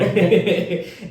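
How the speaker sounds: bursts out laughing, high and loud; a real laugh at his own joke. Real, not acted. Laughing after telling his team about his accent.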